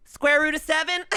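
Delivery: high, eager question; eager know-it-all. Retold or imitated. A hint of the keen kid showing off in class. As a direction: show off to the teacher. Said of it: "he was like".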